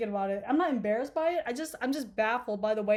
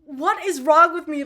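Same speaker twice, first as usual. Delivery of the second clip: exasperated outburst; 5 semitones higher, 11 dB louder, slower than usual. Real, not acted. Exasperated at her own behaviour.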